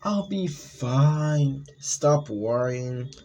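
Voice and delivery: high, very slow, syrupy reassurance; slow sweet girlfriend. Acted. Girlfriend brushing off her boyfriend's worry, slow and syrupy. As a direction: drag it out sweetly, a lure. Girlfriend voiced in horror story.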